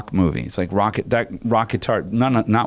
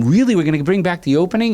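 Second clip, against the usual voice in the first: much higher, quick and eager; thrilled disbelief; 9 semitones higher, faster than usual. Retold or imitated. Composer answering the director about the opening, thrilled and quick. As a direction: jump at the good news.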